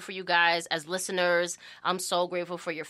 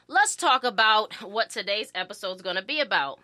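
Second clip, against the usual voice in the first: ordinary talk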